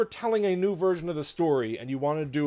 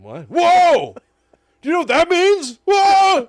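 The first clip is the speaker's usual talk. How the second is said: much higher and louder amazed yells; astonished shout; 14 dB louder than usual. Retold or imitated. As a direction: gasp and shout in total shock. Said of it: "You're like".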